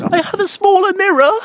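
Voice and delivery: very high, loud wailing imitation; hammed-up high-pitched crying voice; mock crying woman. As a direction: squeal it like a caricature of a crying film character.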